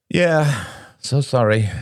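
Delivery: high, strained apology; emotional, half-sarcastic apology; bitter apology. Acted. Moist-eyed, sarcastic apology to the table.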